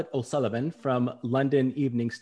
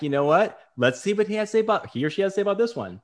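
quick, higher inner thought; rattled off fast, curious; a fast, lifted aside to himself; 6 semitones higher, faster than usual. Retold or imitated. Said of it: "so I'm like".

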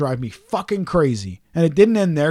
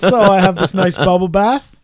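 talking through a laugh, louder; 3 semitones higher, 6 dB louder than usual. Real, not acted. Telling his friend about the bath, laughing. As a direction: laugh while you tell it.

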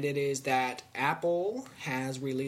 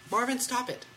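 scolding turtle; 9 semitones higher than usual. Real, not acted. Scolding his pet turtle.

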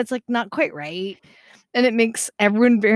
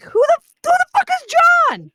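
loud, high-pitched retold outburst; exasperated yell; 9 dB louder than usual. Daughter fed up with mom quoting John, exasperated shout. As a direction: blow up in mock outrage, shouting.

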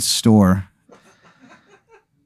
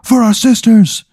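starts plain, climbs much higher into a rallying yell; building rally cry; 5 semitones higher than usual. Acted. Spirit rallies Aaron to fight for every family member, building to a yell. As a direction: rouse him from calm to a pitched battle cry. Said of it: "He's, like, screaming at you".

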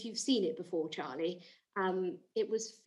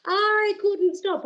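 much higher, louder, gushing; mock delight; 8 semitones higher, 8 dB louder than usual. Chat show host raves to Charlie about the clip. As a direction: gush like you can't contain it.

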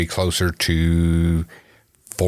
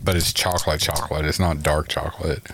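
mouth full; 5 semitones lower, 3 dB quieter than usual. Real, not acted. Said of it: "Sorry, I'm eating chocolate".

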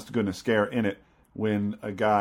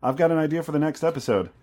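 mock eager idea pitch; 6 semitones higher, 4 dB louder than usual. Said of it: "you came to me and said".

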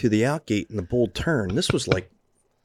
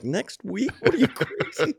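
imitating a stunned friend, much higher; incredulous; 12 semitones higher than usual. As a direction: voice jumps way up in mock disbelief. Mocking an absurd plan in disbelief.

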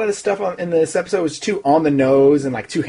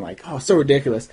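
usual speaking voice

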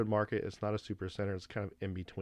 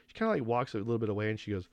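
ordinary talk, nothing marked

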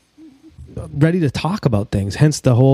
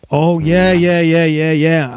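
excited; 5 semitones higher, slower than usual. Real, not acted. Hyped about the next song.

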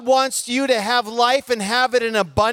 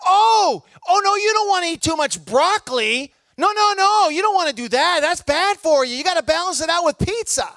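mock unhealthy adviser; 7 semitones higher than usual. Mocking an unhealthy person's advice.

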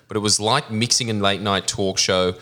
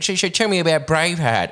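imitating the host; 8 semitones higher than usual. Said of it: "he'd be saying".

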